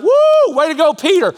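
very high, loud whoop of praise; mock cheer. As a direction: whoop like a crowd cheering a teammate. Recalling the cheer.

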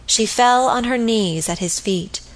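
plain reading voice

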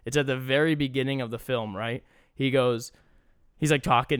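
usual speaking voice

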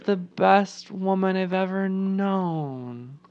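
slow, soft, monotone robot voice; robotic daughter. Retold or imitated. Chelsea tells her mom she loves her, robotic and slow. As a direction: drone it slowly like a robot. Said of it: "killing it with the accents tonight".

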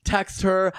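normal talking voice

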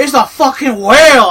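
loud, high mock Boston accent shout; bad Boston accent. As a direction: ham up a Boston accent, badly. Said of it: "I can't even do a Boston accent".

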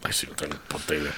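whispered aside; whispering. Real, not acted. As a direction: breathe it out as a secret aside. Muttered aside to cohost.

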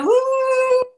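high howling woo; wolf howl. Pretend. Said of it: "He would like a wolf howl".

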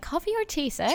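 polite in-flight service voice; flight attendant. Shown as a demonstration. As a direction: offer drinks like cabin crew. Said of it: "I sound like a stewardess".

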